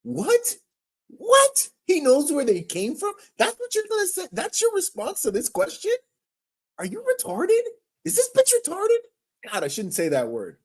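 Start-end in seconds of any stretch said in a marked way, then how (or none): 0.0-9.5 incredulous. Real, not acted. Reacting incredulously to press answer.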